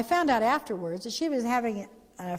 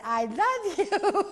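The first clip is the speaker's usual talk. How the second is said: slow, higher, laughing I love you; 9 semitones higher, much slower than usual. Retold or imitated. Leaving the last word to angry Brenda, laughing. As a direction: tease her with a laugh.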